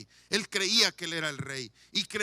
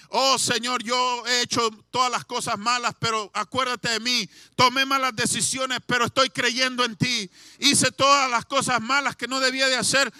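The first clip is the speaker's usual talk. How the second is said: loud, fervent pleading cry to the Lord; pleading prayer; 4 semitones higher, 9 dB louder than usual. Acted. Preacher voicing the repentant thief begging the Lord, loud. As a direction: beg for mercy at full voice.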